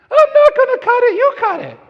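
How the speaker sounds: squeaky put-on wife voice pushing it back; imitating wife. Husband mimics his wife refusing to cut hair, high mock voice. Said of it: "my wife is like".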